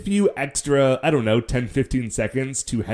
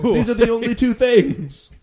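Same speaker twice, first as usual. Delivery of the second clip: laughing panic; 9 semitones higher, 4 dB louder than usual. Retold or imitated. Continuing panicked quote.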